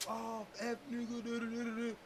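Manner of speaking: mock rowdy. Man mimicking people who resist arrest, mock rowdy. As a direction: parody someone mouthing off, trailing into nonsense syllables. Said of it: "act all rowdy with you".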